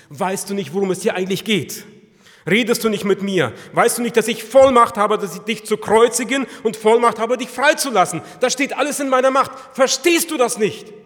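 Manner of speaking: loud, high, mounting outburst; angry demand. Acted. Pilate demands that silent Jesus answer him, angrily. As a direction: browbeat a silent prisoner at full voice.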